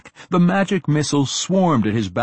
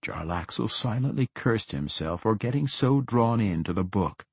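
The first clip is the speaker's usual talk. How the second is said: usual speaking voice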